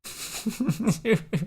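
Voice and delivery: high laughing burst. Real, not acted. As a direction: crack up laughing. Host laughs at James.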